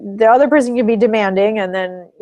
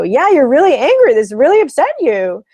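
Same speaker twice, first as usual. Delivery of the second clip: higher and louder, strongly validating; emphatic validation; 7 semitones higher, 6 dB louder than usual. Shown as a demonstration. Therapist to angry partner, emphatic loud validation. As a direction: hold their anger back up to them, emphatically. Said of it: "I'll validate their anger and go".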